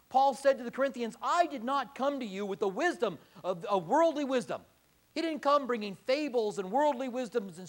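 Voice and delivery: loud, driving sermon delivery; fiery preaching. Acted. Pastor exhorting his congregation, full-force preaching.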